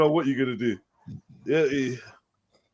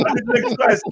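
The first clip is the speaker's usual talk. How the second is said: laughing retelling; 5 semitones higher, 5 dB louder than usual. Retold or imitated. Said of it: "He said".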